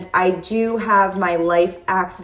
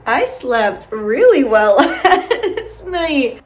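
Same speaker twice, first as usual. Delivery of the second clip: slow, louder, through laughter; laughing; 5 semitones higher, 6 dB louder, slower than usual. Real, not acted. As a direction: laugh while speaking. Laughing at the camera.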